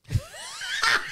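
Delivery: high laugh breaking into a shout. Real, not acted. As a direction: let the laugh explode. Laughing at the flight attendant twist.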